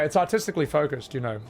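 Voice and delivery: ordinary talk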